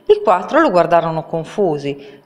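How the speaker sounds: usual speaking voice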